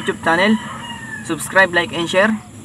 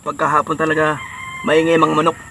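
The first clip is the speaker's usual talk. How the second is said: with food in mouth; eating between words; talking while chewing. Real, not acted. Seen 0: eats egg and fish throughout, food goes into mouth at 2.2.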